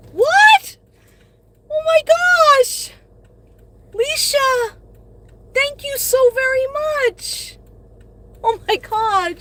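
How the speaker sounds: high, loud, gushing thanks; shrieking happy gratitude; overjoyed surprise. Real, not acted. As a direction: squeal with delight at the gift. Thanking viewer for a super chat.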